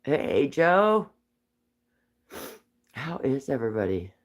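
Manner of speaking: ordinary talk, nothing marked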